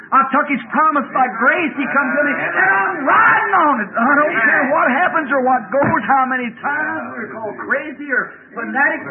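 high, loud revival preaching; full-force preaching. Real, not acted. Preacher exhorting the congregation to ride on the promise, preaching hard. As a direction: drive it home to the congregation, peaking in shouts.

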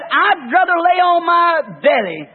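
preacher thundering his retold answer to the rabbi; defiant declaration. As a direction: hurl the reply like a challenge. Retelling his defiant reply.

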